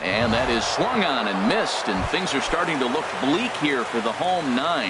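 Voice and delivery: steady play-by-play call; radio baseball announcer. Acted. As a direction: call the pitches like live radio.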